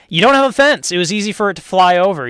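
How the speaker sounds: high, loud, pointed jab about the fence; accusing jab. Retold or imitated. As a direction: blame the neighbor for having no fence. Neighbor blames missing fence.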